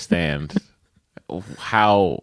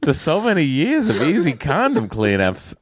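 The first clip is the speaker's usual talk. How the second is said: put-on Boston accent; 10 semitones higher than usual. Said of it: "read in a Boston accent".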